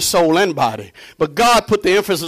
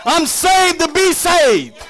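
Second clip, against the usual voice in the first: full-force preaching; 9 semitones higher than usual. Real, not acted. Preacher proclaiming salvation to the congregation, high-pitched preaching. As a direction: preach it high with long held endings.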